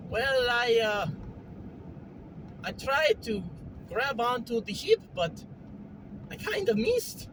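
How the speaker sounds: lilting, Mario-style character voice. Acted. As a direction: talk like a cheerful video game plumber. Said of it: "You sound like Mario".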